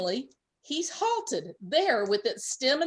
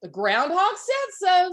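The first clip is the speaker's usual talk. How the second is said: higher, louder, slow cheer; joyful; 8 semitones higher, 5 dB louder than usual. A narrator's reading. Rabbit hopping with joy, cheerful. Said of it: "said the rabbit hopping with joy".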